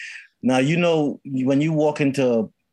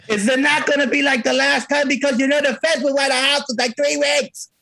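mock New Jersey; 10 semitones higher than usual. Husband calls to his wife Martha in a mock New Jersey accent.

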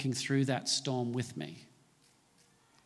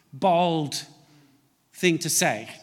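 normal talking voice

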